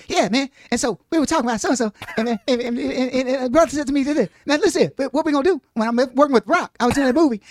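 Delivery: sped-up, animated storyteller riffing on a buddy; Kevin Hart impression. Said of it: "it'd be like".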